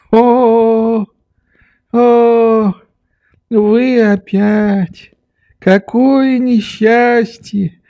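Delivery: put-on high, very slow old man's lament; dismayed old doctor. A narrator's reading. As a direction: moan each word out long and patiently.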